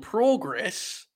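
"Progress" high, first vowel drawn out as "praw"; third-way progress. Shown as a demonstration. Host trying a third way, high drawn-out "PRAW-gress". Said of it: "We're not adding a third one".

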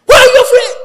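loud question. Retold or imitated. God asking loudly.

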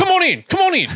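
high, eager imitated welcome; acting out Spud. Spud waving the storyteller inside, high imitated call. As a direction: call them in, voice thrown up high. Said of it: "And Spud goes".

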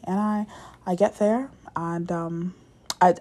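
ordinary talk, nothing marked

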